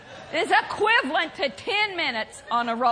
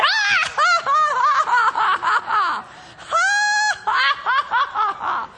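long high fake laugh in bursts; demonstrated belly laugh; 9 semitones higher, faster than usual. Shown as a demonstration. Preacher shows what to do about bills, demonstrated laugh. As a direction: keep forcing out rolling ha-ha's. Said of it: "Faking a laugh like I just did".

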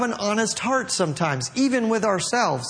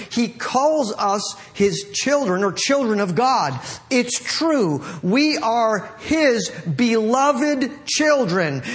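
measured, higher-pitched proclamation; scripture reading; 4 semitones higher than usual. Acted. Preacher reads the translation to the congregation, emphatic declamation. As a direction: read it out deliberately, lifting each phrase.

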